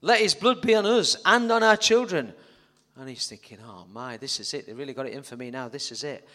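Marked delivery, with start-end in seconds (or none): 0.0-2.3 loud crowd. Acted. Crowd voiced loudly. 3.6-6.3 quiet dread. Acted. Barabbas fearful thoughts.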